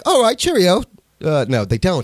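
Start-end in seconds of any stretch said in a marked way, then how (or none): 0.0-0.8 hammed-up British farewell, pitched up; mock British accent. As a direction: sign off in a put-on British accent. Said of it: "don't really talk like that over there".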